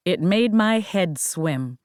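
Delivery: plain reading voice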